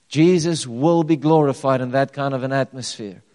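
loud, driving sermon delivery; preaching at full force. Acted. Pastor preaching loudly to his church about glory. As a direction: preach it out to the congregation with fire. Preaching climax before the stories.

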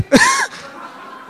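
squealing burst of laughter; high laugh. Real, not acted. Laugh after shooing dog.

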